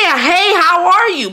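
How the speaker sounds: high, stretched-out Southern hello; Southern greeting. Retold or imitated. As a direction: imitate a Southern stranger's drawn-out greeting. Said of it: "it's the way that you say it".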